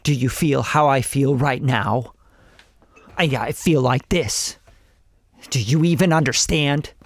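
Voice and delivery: low, stilted delivery in separated phrases; weird unnatural talking. Shown as a demonstration. Coach shows rappers how nobody talks, weird and stilted. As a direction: talk at people stiffly, as nobody really would. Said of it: "if you talk to people like that".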